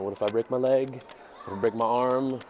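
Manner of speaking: ordinary talk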